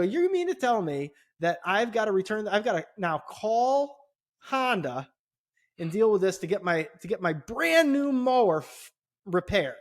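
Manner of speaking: fuming at the manager; angry customer confronting the manager; retold fuming. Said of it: "So I was fuming".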